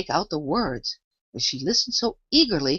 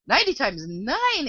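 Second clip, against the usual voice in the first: in despair; 8 semitones higher, slower than usual. A narrator's reading. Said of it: "Alice repeated in despair".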